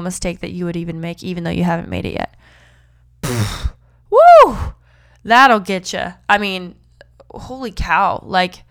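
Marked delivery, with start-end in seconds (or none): 4.1-4.6 very high, loud whoop of delight; excited whoop. Real, not acted. Host whooping with excitement after reading a verse. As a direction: let out a thrilled woo.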